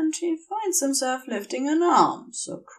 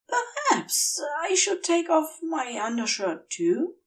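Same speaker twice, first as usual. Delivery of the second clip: dazed suggestion; 6 semitones higher than usual. A narrator's reading. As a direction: wonder aloud, drifting. Feverish Aziraphale speaks.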